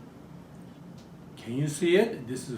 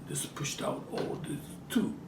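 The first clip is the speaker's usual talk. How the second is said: voiceless mutter to self; whispered aside; 9 dB quieter than usual. Real, not acted. Fiddling with the wrestler top, whispering to herself. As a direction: drop to a private whisper.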